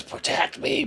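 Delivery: breathy whispered plea; hag's whisper. Acted. The hag whispers to its allies, a desperate plea. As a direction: whisper it like a cowering creature. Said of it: "just says".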